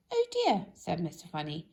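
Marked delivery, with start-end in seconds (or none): 0.1-0.7 high, sudden dismayed cry; a squeaky little gasp of dismay; dismayed oh dear. A narrator's reading. Mister Funny dismayed.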